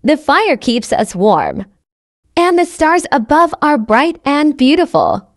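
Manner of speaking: ordinary talk, nothing marked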